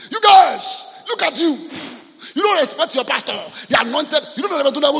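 high, loud indignant shout; angry church member. Retold or imitated. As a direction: scold the congregation like an offended member. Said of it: "The person will come and say".

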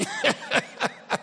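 sudden high-pitched burst of laughter. Real, not acted. Pastor laughing at his own whiz-kid line.

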